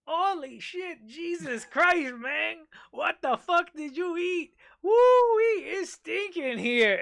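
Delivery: high, slow mock-disgusted voice of a stranger. Bathroom stranger reacts to the smell, high and slow disgusted. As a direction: ham it up, recoiling from the stink. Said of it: "and he's going".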